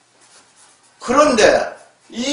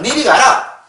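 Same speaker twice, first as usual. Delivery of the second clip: Gyeongsang dialect; 4 semitones higher, slower than usual. Shown as a demonstration. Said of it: "경상도 말은".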